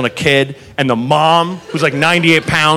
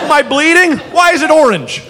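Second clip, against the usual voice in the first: mock panicked diner; 10 semitones higher than usual. Confused injured diner.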